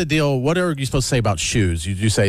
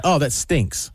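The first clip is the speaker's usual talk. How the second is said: the way he says stinks; 4 semitones higher, much slower than usual. Shown as a demonstration. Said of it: "you just sound stupid".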